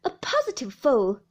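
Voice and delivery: loud, emphatic insult; forceful scolding; angry. A narrator's reading. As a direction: drive the insult home loudly. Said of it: "rising angrily".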